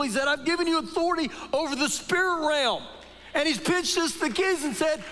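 high, ringing preacher's voice, building up; full-force preaching. Acted. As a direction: preach it to the crowd.

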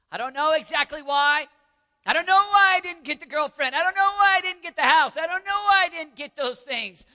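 loud, high impassioned declaration; crying out to God. Acted. Believer telling God they trust despite losses, shouting. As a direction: shout it as a defiant prayer.

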